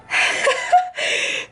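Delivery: high airy giggle; breathy little laugh at the tan line; quick high giggle. Real, not acted.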